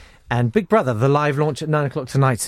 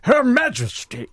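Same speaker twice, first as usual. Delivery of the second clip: high, slow, slurping theatrical voice; hammed-up thespian proclamation; Brian Blessed impression; 9 semitones higher, slower than usual. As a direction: roll it out grandly, stretching the words. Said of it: "Can you say it in a Brian Blessed voice?".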